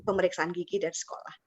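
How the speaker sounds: ordinary talk, nothing marked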